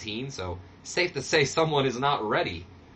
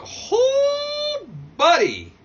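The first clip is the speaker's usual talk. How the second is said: loud, high shocked cry on oh; oh buddy shout; 8 dB louder, much slower than usual. Real, not acted. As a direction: yell in dismay at the result. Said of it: "reacting to bad rating".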